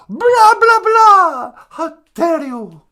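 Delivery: loud, high mock outrage; hammed-up wailing tirade, very high; Greta Thunberg. Activist rebukes the host, mock high-pitched outrage. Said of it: "Greta Thunberg".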